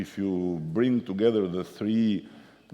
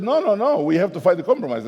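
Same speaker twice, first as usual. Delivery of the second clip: 1 backpedaling supervisor; 10 semitones higher, 8 dB louder, faster than usual. Retold or imitated. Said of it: "He said".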